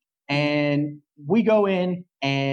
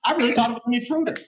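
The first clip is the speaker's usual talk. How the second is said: excited; 8 semitones higher, faster than usual. Real, not acted. Retelling fright over the wig head.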